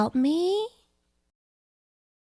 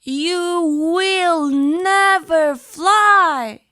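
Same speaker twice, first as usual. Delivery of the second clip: loud, very slow, drawn-out verdict; a big looming voice crushing a small bird's hope; slow booming refusal; 4 semitones higher, 4 dB louder, much slower than usual. Acted. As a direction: pronounce it like a final judgement. Stern figure refuses the bird.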